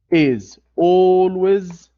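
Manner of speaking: is always said slow and loud; stretched and loud for emphasis; slow emphatic. Shown as a demonstration. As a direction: drag out "is always" to make the rule stick. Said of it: "the gerund plus noun".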